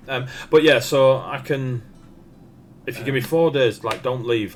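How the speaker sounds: loud, high smith voice pleading for time. Acted.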